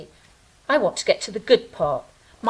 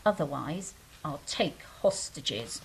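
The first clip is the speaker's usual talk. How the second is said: a hammed-up hushed threat; mock menace; 9 semitones lower, 10 dB quieter than usual. Joking threat after bagel demand.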